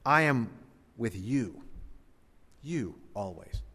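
"you" isolated between pauses, softly repeated; pause, then dwell softly on "you"; stress on YOU. Shown as a demonstration. Preacher emphasizes a different word of the promise, dwelling on YOU. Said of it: "Just emphasize a different word".

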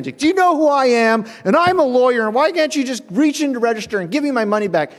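high, loud, indignant tirade; mock the full ire of an outraged lawyer; irate customer. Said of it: "dealing with the full front ire of this man".

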